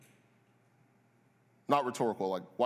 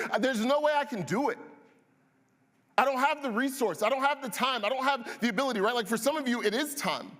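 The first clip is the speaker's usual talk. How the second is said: voicing overwhelmed believer; 9 semitones higher than usual. Believer pleading with God about no time, higher and strained. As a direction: pour out the excuses in a rising plea. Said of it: "where is it that you're like".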